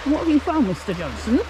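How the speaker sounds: usual speaking voice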